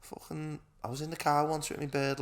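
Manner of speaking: usual speaking voice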